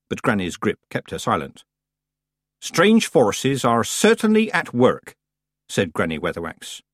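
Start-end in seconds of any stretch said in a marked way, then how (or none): 2.6-5.1 high, slow and weighty; deliberate, loaded agreement; pointed formality. A narrator's reading. Granny answers her rival's accusations, pointedly formal.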